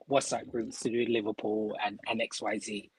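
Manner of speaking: normal talking voice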